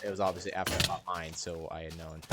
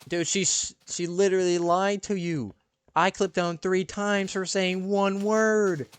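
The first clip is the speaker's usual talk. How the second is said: indignant protest; 8 semitones higher, 6 dB louder, slower than usual. Retold or imitated. Boy protests to teacher.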